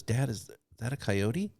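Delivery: ordinary talk, nothing marked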